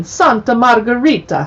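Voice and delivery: put-on Italian accent, lilting place name. Shown as a demonstration. Host showing off her chapter-title Italian accent. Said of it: "my Italian accent".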